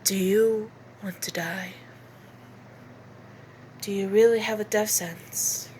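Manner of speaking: low, cold menacing whisper; Maki voice. Acted. An assassin threatening a liar, low and cold. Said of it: "more cold and down-pitched".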